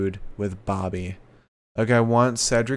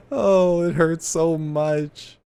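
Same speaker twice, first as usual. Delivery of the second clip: mock anguish; 7 semitones higher, 4 dB louder, much slower than usual. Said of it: "mock pain over the breakup".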